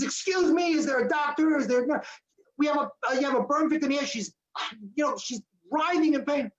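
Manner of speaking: high, urgent call down the empty hall, pitch way up; urgent call for help. Retold or imitated. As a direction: call out desperately for a doctor. Calling for help in hospital hall.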